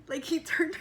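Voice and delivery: usual speaking voice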